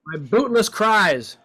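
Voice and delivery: milk the misery, slowly; mock lament. Shown as a demonstration. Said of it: "let's look at bootless".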